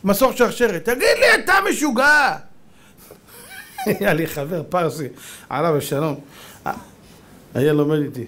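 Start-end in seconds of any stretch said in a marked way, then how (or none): 0.8-2.4 high-pitched exclamation of disbelief; incredulous outcry. Acted. Onlooker calls up to man with chainsaw in treetop, incredulous. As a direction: call him crazy in a high voice.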